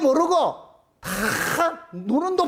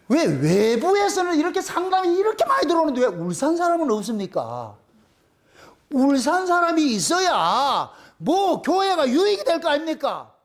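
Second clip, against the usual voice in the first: indignant protest; 5 semitones higher than usual. Retold or imitated. Preacher retelling his challenge to God, indignant protest. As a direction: argue it out, pitched up and insistent. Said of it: "내가 하나님 앞에 엄청 따졌거든".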